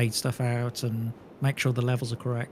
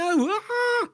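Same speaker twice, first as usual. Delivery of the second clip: panicked cry. Real, not acted.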